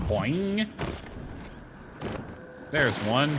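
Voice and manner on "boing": a bouncy, raised boing for a jump; cartoon boing noise; mock boing sound. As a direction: voice the spring of the jump playfully.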